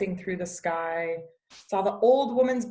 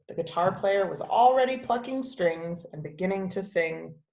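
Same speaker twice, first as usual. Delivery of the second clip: ordinary delivery, nothing marked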